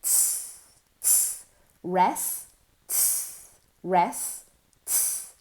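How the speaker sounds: alternating unvoiced ts taps and a spoken res; syllable drill. Shown as a demonstration. Teacher drills the parts of rests. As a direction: drum ts, say res, back and forth. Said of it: "like a drum".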